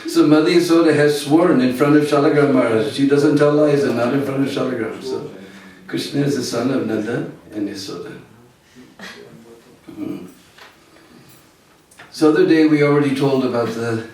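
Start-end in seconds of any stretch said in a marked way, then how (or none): none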